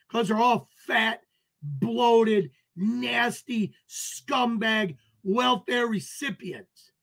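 ordinary talk

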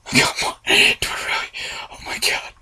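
scared whisper; barely breathing the words; frightened hushed whisper. Real, not acted.

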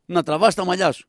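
normal talking voice

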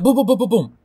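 rapid high, loud booming burst; excited high-pitched mouth gunfire; mock gunfire booms. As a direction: fire off booms like a gun. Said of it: "I'll be like".

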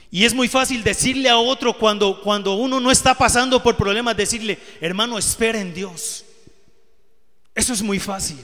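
loud, high, rising preacher's rebuke; fervent preaching. Acted. A preacher loudly challenging the congregation. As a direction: hammer home how easy advice is.

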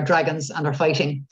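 normal talking voice

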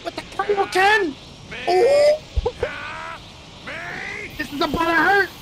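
hyped yelling. Real, not acted. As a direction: yell it like a gamer going wild. Hyped gamer yelling at fight.

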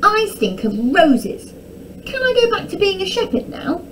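high, plaintive storybook plea; complaining boy. A narrator's reading. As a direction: whine to the maid to be let off. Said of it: "Tom asked the maid".

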